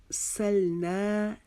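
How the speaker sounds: slow सल ना with held "naa"; measured recitation, long final ना; lengthened vowel. Shown as a demonstration. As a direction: chant and stretch the ना. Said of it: "एक अलिफ के जितना खींचना है".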